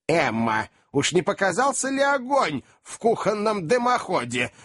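put-on high-pitched old lady's inquiry. A narrator's reading. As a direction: ask as an alarmed old lady, voice rising high. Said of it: "осведомилась старая леди".

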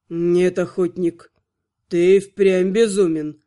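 low, slow, doomed exhale; resigned sigh. A narrator's reading. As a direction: give up with a weary breath. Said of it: "обречённо выдохнул Артур".

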